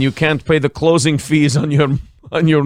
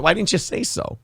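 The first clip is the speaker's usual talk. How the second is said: normal talking voice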